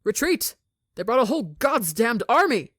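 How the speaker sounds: high, loud yell of warning; panicked shout. Acted. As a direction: scream it across the camp. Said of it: "shouts in warning".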